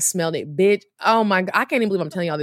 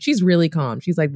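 usual speaking voice

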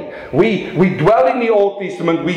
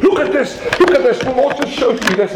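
high, eager look-at-this; retold excitement, pitched up; excited; 8 semitones higher than usual. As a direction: burst in thrilled to share. Excited retelling of a discovery.

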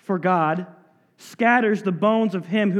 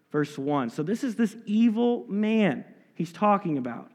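ordinary delivery

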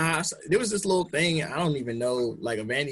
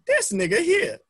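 indignant wife; 13 semitones higher than usual. Retold or imitated.

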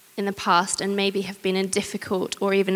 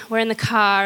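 normal talking voice

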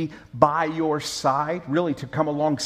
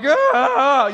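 long, high, loud exaggerated moan; out-moan the other patient, hammed-up and drawn out; louder mock moan; 9 semitones higher, 7 dB louder than usual. Patient competing for the nurses' attention, louder hammed-up moan. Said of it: "just a little bit louder".

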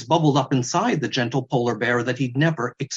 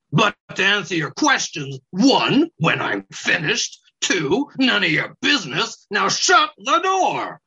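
higher, contemptuous weasel voice; 6 semitones higher than usual. Acted. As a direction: spit the answers out like a weasel.

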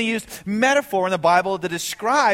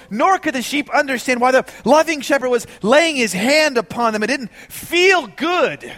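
raised, loud preaching voice; fervent preaching; 5 semitones higher, 6 dB louder than usual. Acted. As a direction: preach it out with rising fervour. Preaching at full force.

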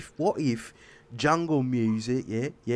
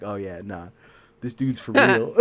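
imitating a gruff dude; 7 semitones lower than usual. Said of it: "she puts so much bass in her voice".

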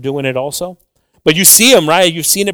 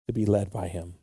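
normal talking voice

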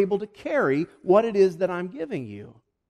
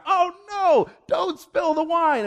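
hammed-up alarmed cry, much higher and louder; mock gasping crowd; 14 semitones higher, 6 dB louder, slower than usual. The crowd reacting to Jesus, hammed-up alarmed gasp. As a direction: play the horrified crowd for laughs. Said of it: "you can almost hear the gasp from the crowd".

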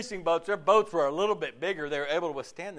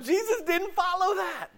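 higher, laughing comeback; mock retort. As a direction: toss it off with a laugh.

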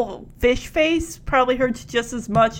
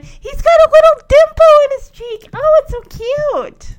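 very high-pitched gushing coo; cooing adoration; 13 semitones higher, 6 dB louder than usual. Real, not acted. Gushing over a child's dimple.

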